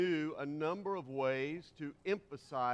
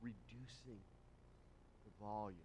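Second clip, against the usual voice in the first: much softer, almost dropped away; reduced volume; 7 semitones lower, 17 dB quieter than usual. Shown as a demonstration. Preacher showing the congregation quiet emphasis, hushed. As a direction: drop to a hush. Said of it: "emphasize by reducing".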